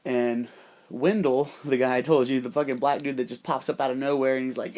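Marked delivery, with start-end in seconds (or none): none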